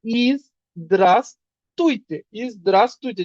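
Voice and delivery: slow "ইজ দ্রাস তুইতে" broken into parts; teacher chunking "ইজ দ্রাস তুইতে"; syllable-split demo. Shown as a demonstration. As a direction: split "ইজ দ্রাস তুইতে" into three beats, slowly. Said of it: "Russian আসবেন".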